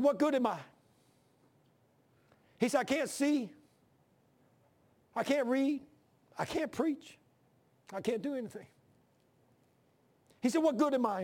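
pitched high, straining with grief; despairing old man. Retold or imitated. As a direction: plead like a dying man who feels useless. Dying preacher's despairing words retold.

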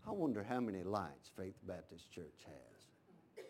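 very low, hushed, slow musing; imagine God pondering in a deep, quiet drawl. Pretend. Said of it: "you think God ever went".

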